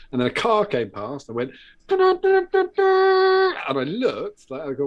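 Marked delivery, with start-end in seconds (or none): 1.9-3.5 high, loud blared "dun dun dun"; imitated car noise. Car passing during a call to mum, loud imitated blare.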